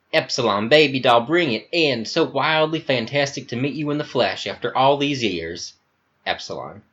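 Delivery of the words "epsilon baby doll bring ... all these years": higher-pitched, effusive American Southern voice; flamboyant Southern accent. Acted. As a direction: gush theatrically in a Southern accent.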